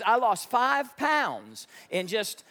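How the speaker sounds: ordinary delivery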